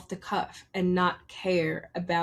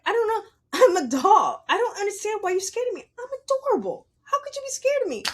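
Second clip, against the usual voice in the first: very high-pitched, mock-innocent plea; innocent protest; 12 semitones higher, 4 dB louder, faster than usual. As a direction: protest your sweetness way up high. Acts out innocent protest to people.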